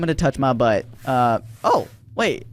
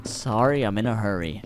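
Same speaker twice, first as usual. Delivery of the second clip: mock creepy customer; 7 semitones lower, 5 dB quieter than usual. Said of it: "Sure you are".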